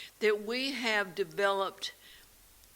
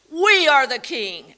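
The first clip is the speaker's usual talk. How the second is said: booming, slowed-down proclamation, louder and higher; real loud; 6 semitones higher, 13 dB louder, slower than usual. Retold or imitated. Teacher to a silent class at the start of the lesson, booming loud proclamation. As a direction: hurl the line at a silent class like a decree. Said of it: "And I would say it real loud".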